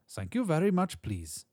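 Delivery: put-on high German-Polish accent, dropping away on the last word; polite thanks in a high Central European accent; little man's high German or Polish accent. A narrator's reading. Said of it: "the accent German or Polish".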